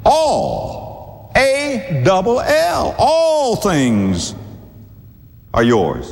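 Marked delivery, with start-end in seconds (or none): 0.1-4.4 "All" drawn out, then spelled high and slow; preacher's high, slow emphasis on "All"; stress on ALL. Real, not acted. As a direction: hammer "All", stretching "A double L" high. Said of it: "I mean, all, that's pretty big".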